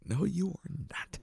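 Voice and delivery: high, soft mock disbelief; sceptical denial. Caller doubting the tech support agent, mock disbelieving.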